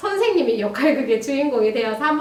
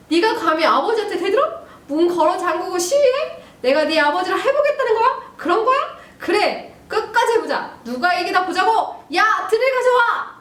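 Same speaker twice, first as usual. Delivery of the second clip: high, raised confrontational tirade; acted furious dad; 7 semitones higher, 3 dB louder than usual. Father after kicking son's door open, acted angry tirade. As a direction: challenge a defiant son, building to an order. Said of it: "자, 아빠".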